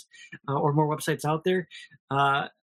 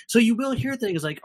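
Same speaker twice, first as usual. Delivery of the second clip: ordinary talk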